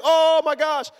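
mock panic. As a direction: imitate a horrified family. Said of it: "my whole family's in panic".

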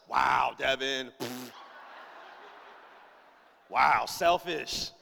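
very slow, drawn-out WOW; low, scornful guilt trip; disgusted disapproval. Acted. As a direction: let each word hang in judgement. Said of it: "me and Ira mad at Devin".

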